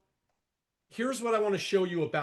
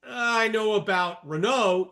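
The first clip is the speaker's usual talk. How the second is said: put-on slow, louder French accent, Renault said the French way; drawn-out French accent on Renault; 3 semitones higher, 6 dB louder, slower than usual. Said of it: "Where does he get that French accent?".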